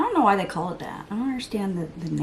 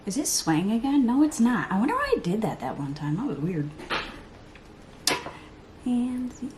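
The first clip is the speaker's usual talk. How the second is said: normal talking voice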